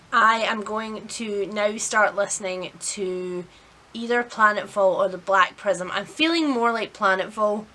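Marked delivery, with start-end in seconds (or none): none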